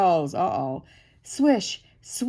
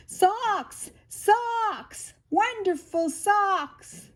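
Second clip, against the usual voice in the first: yelling; 9 semitones higher, much slower than usual. A narrator's reading. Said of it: "Tina ran home and yelled".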